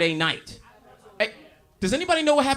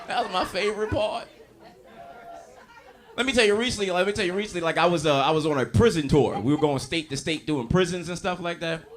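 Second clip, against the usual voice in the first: ordinary talk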